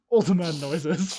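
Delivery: put-on creaky old man, slowed down; lightly voiced old man. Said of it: "I'm trying an old man voice".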